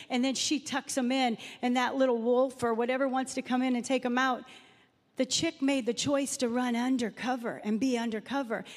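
usual speaking voice

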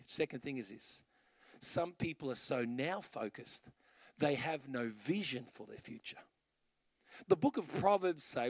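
normal talking voice

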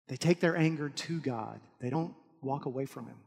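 usual speaking voice

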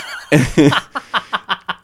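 high bursting laugh; loud laugh. Real, not acted. Laughing at rude quote.

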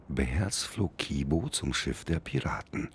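ordinary delivery, nothing marked

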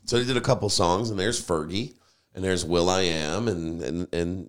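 ordinary talk

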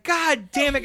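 hammed-up frustration; retold cursing. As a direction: curse it out like you just fell. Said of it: "I immediately start cussing".

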